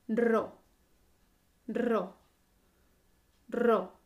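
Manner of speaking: Ro with a strong trilled R; rolled R. Shown as a demonstration. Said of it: "hacemos vibrar la R".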